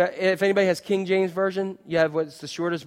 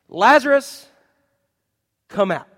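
loud, higher command across the tomb; calling out; 4 semitones higher, 7 dB louder than usual. Acted. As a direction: call Lazarus out with authority. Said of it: "three words".

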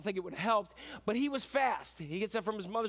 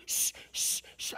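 repeated insistent shushes; hushing. Acted. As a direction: shush someone who keeps apologizing. Father hushes the confessing son.

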